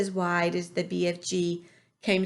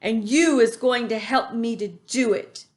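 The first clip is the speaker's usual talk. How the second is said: loud bullying rant; insulting shout; 2 semitones higher, 6 dB louder than usual. A narrator's reading. Bloodbottler berates the BFG with insults. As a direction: hurl the insults. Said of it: "he shouted".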